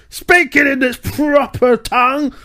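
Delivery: high, slow, hammed-up angry Brit; far-right British. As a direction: rant it like a British nationalist caricature. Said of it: "sort of far right British".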